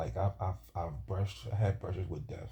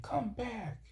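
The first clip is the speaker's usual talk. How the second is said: imitating a shout from the shore, pitched way up; retold yell; 4 dB quieter than usual. People on the beach calling him back, mock high shout. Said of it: "And they yelling".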